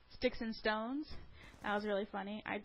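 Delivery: ordinary talk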